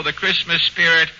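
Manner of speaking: usual speaking voice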